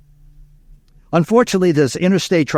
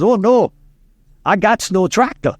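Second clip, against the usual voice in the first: put-on Cajun accent, pitched up; old Cajun farmer; 5 semitones higher than usual. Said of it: "an old Cajun from Southwest Louisiana, farmer".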